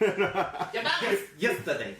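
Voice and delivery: high, loud, slow New York voice through laughs; laughing mock New York accent. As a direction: ham up a New Yorker while laughing. Continuing New York bit, laughing.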